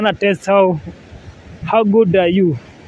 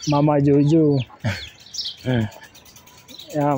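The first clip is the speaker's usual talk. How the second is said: usual speaking voice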